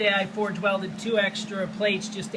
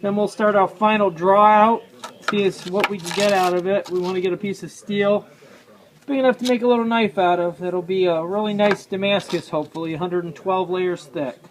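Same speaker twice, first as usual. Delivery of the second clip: talking through a mask; 6 dB louder than usual. Real, not acted. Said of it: "I'm talking through a mask".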